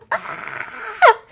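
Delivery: high burst of squealing joy; excited squeal. Real, not acted. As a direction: let out a sudden thrilled squeal.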